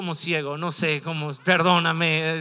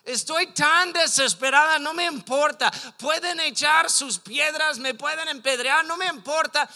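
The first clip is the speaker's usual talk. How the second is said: higher, louder cry of a desperate woman; desperate defiance; 6 semitones higher, 4 dB louder than usual. Retold or imitated. Preacher voices the desperate woman pushing to Christ, desperately defiant. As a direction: act out her urgent desperation. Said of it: "bien desesperada".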